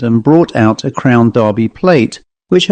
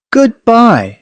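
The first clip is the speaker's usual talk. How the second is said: high bright goodbye, forced; a mild hint of forced cheer calling goodbye; falsely cheerful call; 10 semitones higher than usual. A narrator's reading. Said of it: "she called out with false cheerfulness".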